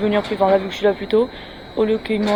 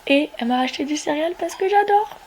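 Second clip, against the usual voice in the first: usual speaking voice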